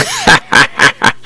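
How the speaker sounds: hushed wicked laugh, falling; evil breathy laugh. A narrator's reading. Villain laughs at his helpless captive, hushed cackle.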